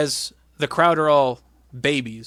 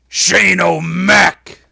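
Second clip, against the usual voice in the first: loud, drawn-out wrestling proclamation; shouted name; 3 semitones higher, 10 dB louder, much slower than usual. Retold or imitated. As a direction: bellow the name slowly for the arena. Acts out the challenger announcement.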